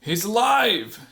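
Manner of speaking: high, slow marvelling cry; lightly voiced woman amazed at her creation; creator woman's awe. A narrator's reading. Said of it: "she says".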